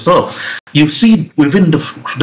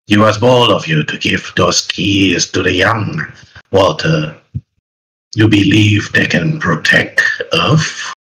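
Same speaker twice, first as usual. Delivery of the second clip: low, drawn-out monster voice; slow, lower creature taunt, words stretched out; menacing creature; 5 semitones lower, slower than usual. Acted. Game master as creature taunting old Walter, menacing monster voice. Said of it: "The creature calls him Walter".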